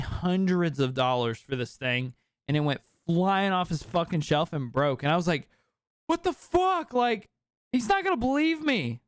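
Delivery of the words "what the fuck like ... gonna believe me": much higher, panicked disbelief; replaying his freak-out, voice shooting up; shocked squeak. Retold shock at broken statue.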